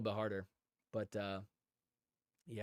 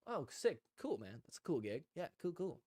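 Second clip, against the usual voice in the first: playing it cool; 2 semitones higher, 6 dB quieter than usual. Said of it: "I was like".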